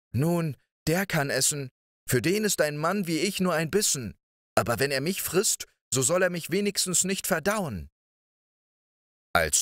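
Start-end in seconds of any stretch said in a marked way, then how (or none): 0.1-7.9 put-on small boy voice. Däumling's thought, high voice.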